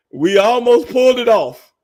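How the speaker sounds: high, loud, amused; gleeful laugh-talk. Real, not acted. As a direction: relive the near miss gleefully. Laughing exclamation.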